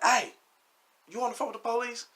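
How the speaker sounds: high, strained call across the room; retold yell. Calling to girlfriend while choking a man, high yell. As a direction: holler "Hey" high and hard, then keep it high. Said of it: "So I yell to her".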